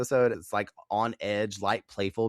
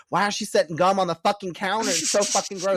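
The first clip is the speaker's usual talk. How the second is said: mock Buffy; 9 semitones higher, 4 dB louder than usual. Buffy's imagined thoughts, mock outraged.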